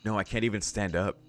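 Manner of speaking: ordinary delivery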